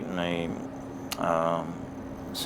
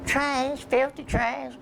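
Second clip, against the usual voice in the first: high, louder falsetto "Trash, filthy trash"; prim lady's insult; 3 dB louder, slower than usual. Retold or imitated. Lady insulting him.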